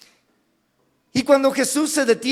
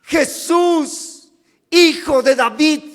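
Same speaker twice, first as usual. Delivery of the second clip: raised voice calling; 4 semitones higher, 5 dB louder, slower than usual. Acted. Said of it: "Levanta la voz y dice".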